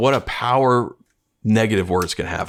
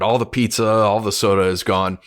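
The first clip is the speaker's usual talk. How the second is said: ordinary talk, nothing marked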